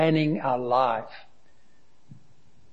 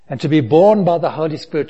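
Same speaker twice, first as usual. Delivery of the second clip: preaching burst; 3 semitones higher, 7 dB louder, faster than usual. Real, not acted. Pastor preaching on rebirth.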